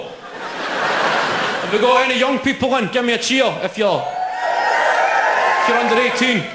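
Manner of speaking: high, projected call to a crowd. Real, not acted. Comic asking young audience members to cheer, calling out. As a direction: call out with stretched words, wanting a cheer.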